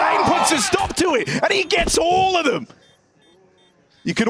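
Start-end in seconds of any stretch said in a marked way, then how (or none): 0.0-2.7 excited shout. Real, not acted. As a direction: yell it as the clutch comes in. Caster calling a clutch play.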